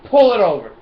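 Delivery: playful pull-over command; mock cop. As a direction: bark it like a traffic cop.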